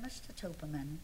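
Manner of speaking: low, soft, controlled address; very quietly. Retold or imitated. Wife confronting husband in the restaurant, very quietly. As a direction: keep it hushed and measured, with formal restraint. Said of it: "very quietly".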